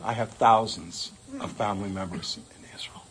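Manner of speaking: hushed, breathy whisper delivering the punchline; whispered reply. Retold or imitated.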